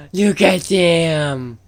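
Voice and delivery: slow, amazed gasp; delighted gasp. Real, not acted. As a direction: gasp then marvel slowly. Gasp watching the dogs.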